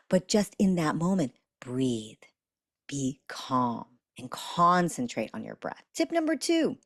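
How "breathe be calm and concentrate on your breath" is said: calm guidance. Shown as a demonstration. Teacher guiding listeners to breathe calmly. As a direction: breathe the words out gently. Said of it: "do that slowly, calmly".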